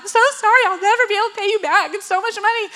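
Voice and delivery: high, rushed hammed-up pleading; overdone sobby confession; mock crying apology. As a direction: imitate a tearful apology tumbling out. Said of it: "once I'm crying".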